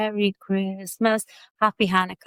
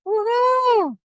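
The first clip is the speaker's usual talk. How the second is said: excited cheer; 5 dB louder than usual. Real, not acted. Cheering January off.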